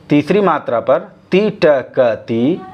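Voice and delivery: normal talking voice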